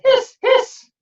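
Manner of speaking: high whistling hiss; kettle hiss. A narrator's reading. Kettle sound.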